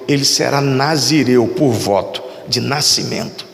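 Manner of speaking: súplica solene em tom mais grave; faça uma prece representada, com voz mais baixa em altura e pausas; oração de Ana. Acted. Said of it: "A oração dela foi assim". (oração solene em tom grave)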